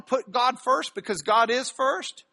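ordinary talk

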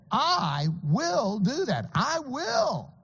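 slow, insistent "I will"; emphatic I will. Shown as a demonstration. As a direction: spell out "I will" word by word. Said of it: "making a point of saying".

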